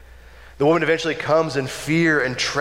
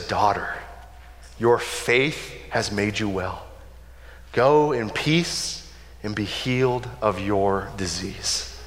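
low, slow and gentle blessing; tender compassion; 6 semitones lower, 4 dB quieter, slower than usual. Retold or imitated. Jesus to the healed woman, tender and slow. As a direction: speak it softly like a gentle father. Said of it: "responds with tender compassion".

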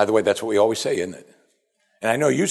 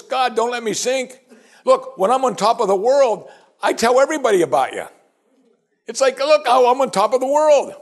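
high, loud wheedling bargain; bartering with God; 13 semitones higher, 5 dB louder than usual. Retold or imitated. Preacher voicing David bargaining with God, high and loud. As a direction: coax God with a deal. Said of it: "bartering with the Lord".

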